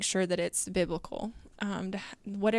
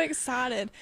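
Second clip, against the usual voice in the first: usual speaking voice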